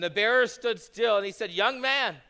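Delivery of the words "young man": loud, ringing command; commanding call. Acted. Jesus commands the dead young man, loud ringing call. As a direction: raise him with authority.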